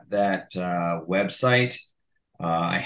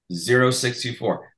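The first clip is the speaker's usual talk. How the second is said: normal talking voice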